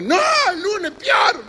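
high, loud, slow mock yell; mock shout. Lab technician yelling at the would-be blood donors, high mock shout.

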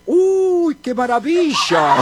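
high, drawn-out joke exclamation; amazed, swooping exclamation; delighted outcry. Acted. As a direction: burst out in mock delight. Cured gangoso leaps and cries out.

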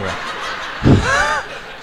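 a real laugh cutting off the joke; high laugh. Real, not acted. Audience laughter.

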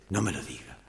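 low, hushed plea from within; voice from inside. Acted. Hidden man to woman confessing, low muffled plea. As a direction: beg quietly not to be told. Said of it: "una voz de adentro".

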